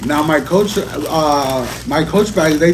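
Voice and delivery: high, slow, delighted exclaim; excited. Real, not acted. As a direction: savour the find with glee. Unboxing, thrilled at dust bag.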